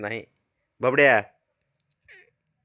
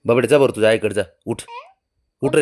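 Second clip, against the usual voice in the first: ordering; 2 semitones lower, 6 dB louder, much faster than usual. Real, not acted. Owner ordering the dog to go to its mother, loud. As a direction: send him off firmly.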